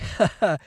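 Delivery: short sheepish laugh; chuckle. Real, not acted. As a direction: laugh at himself. Laughs at own temper.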